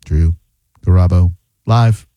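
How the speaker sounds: "Drew", pause, "Garabo", pause, "Live", low; halting, low "Drew ... Garabo ... Live"; broken-up name. Shown as a demonstration. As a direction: chop "Drew Garabo Live" into separate words with gaps. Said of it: "Every time you say it one way".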